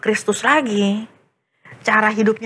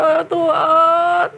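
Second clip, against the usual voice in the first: slow high tearful plea; crying prayer; 8 semitones higher, slower than usual. Acted. Boy praying to God for forgiveness, sobbing. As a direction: beg God for forgiveness through tears. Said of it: "Nangis dia".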